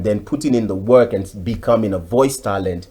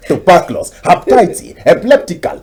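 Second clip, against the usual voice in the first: much higher and louder ranting Nigerian elder, breaking into laughs; mock outraged elder; 10 semitones higher, 7 dB louder than usual. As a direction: rant the list of diseases like an old man in a comic radio drama. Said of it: "And the guy will say".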